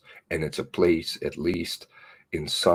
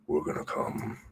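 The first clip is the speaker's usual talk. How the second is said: menacing whisper; 6 dB quieter than usual. Real, not acted. Threat whispered.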